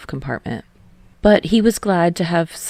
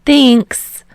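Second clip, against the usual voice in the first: Hinata's voice; 8 semitones higher, 10 dB louder than usual. A narrator's reading. Hinata voiced higher in story.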